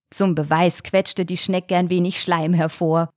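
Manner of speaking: plain reading voice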